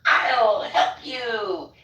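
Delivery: high, slow, drawn-out sing-out; overbearing helper. Retold or imitated. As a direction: stretch it out high like an overeager helper. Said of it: "I hear this voice that goes".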